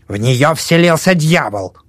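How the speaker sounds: put-on slow, high old woman voice; old woman declaring. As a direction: proclaim it like a superstitious old crone. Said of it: "заявила старуха". (старуха, медленно и высоко)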